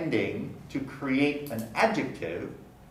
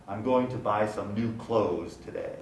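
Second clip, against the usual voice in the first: ordinary talk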